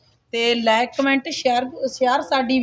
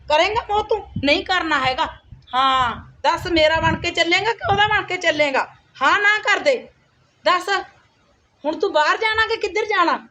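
put-on a sharp, nagging stepmother talking down to the kid; scolding stepmother; 4 semitones higher than usual. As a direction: boss the boy around like a harsh stepmother. Stepmother scolding the little boy.

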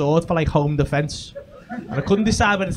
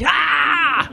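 high hammed-up warrior shout; boss battle yell; 9 semitones higher, 3 dB louder than usual. Grabbing his katana in the dream, mock battle cry. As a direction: charge in with a video-game boss cry. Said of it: "I made the fucking boss noise".

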